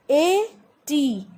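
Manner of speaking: ordinary talk, nothing marked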